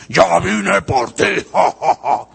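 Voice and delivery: hammed-up horror-movie devil cackling; cartoon devil voice. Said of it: "Satanás, hermano, no te va a decir".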